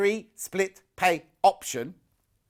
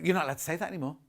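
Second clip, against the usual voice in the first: normal talking voice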